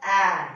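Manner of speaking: "A" said as short a, as in van; short A sound. Shown as a demonstration. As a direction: give "A" its sound, not its letter name. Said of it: "That A is taking sound".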